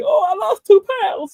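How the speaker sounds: high, loud delighted repeat; light up as if you won something; all happy. Retold or imitated. Patient hearing he lost weight, delighted and loud. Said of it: "And I'm all happy".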